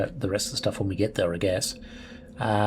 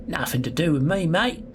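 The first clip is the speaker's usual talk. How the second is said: put-on Australian accent slipping out, higher and rising on mate; botched English accent; 5 semitones higher, 4 dB louder than usual. Gamer attempting a prisoner's English accent, coming out Australian. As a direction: aim for English, land in Australian. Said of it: "That's Australian".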